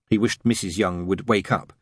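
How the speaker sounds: ordinary delivery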